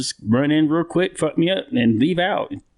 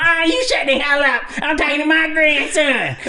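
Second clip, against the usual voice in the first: grandma voice. Retold or imitated. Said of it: "do her voice".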